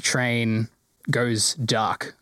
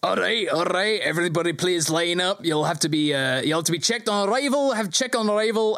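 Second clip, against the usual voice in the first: gruff guard calling out; 5 semitones higher than usual. Acted. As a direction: call the crowd to line up. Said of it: "a gruff, heavy voice calls out".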